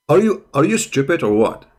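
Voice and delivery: ordinary talk, nothing marked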